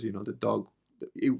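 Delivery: ordinary talk, nothing marked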